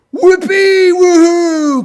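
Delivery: very high, loud whoop; squealed, jubilant hurrah; cheering. A narrator's reading. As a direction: whoop long with sudden joy. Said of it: "cried the animals of the zoo".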